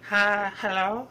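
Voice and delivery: high, squeaky mock hello; silly high greeting. Prank a telemarketer on the phone with a silly high hello. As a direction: answer a spam call in a silly high voice. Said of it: "just be like".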